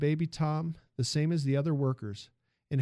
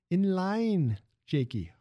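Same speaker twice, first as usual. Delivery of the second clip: put-on lively Italian accent, raised pitch; 5 semitones higher, much slower than usual. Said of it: "the music of the man's accent".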